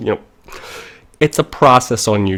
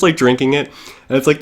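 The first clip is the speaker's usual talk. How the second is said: ordinary talk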